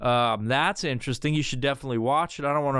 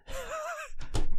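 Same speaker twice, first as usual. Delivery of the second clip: short high burst of laughter. Real, not acted. Laughing at his own groan over the messages, a high burst. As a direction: laugh at yourself.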